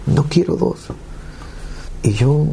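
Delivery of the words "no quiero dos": high-pitched imploring; earnest appeal, pitched up; retold pleading. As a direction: re-enact bargaining with God. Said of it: "le pedí a Dios".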